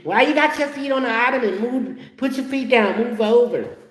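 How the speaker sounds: high, rapid-fire nagging; nagging girlfriend. Retold or imitated. Girlfriend bossing her man off the ottoman, nagging. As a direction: pester him in a high-pitched voice. This girl comes in.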